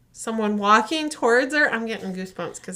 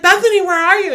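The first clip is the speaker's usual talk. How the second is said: high, loud frantic call; panicked call; 9 semitones higher, 10 dB louder, faster than usual. Retold or imitated. Mother finds the closet empty and calls out frantically. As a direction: shout the name in panic. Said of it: "she starts panicking".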